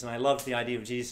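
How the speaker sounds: ordinary talk, nothing marked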